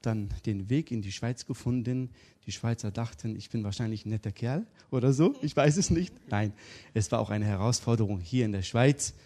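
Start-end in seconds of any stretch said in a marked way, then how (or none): none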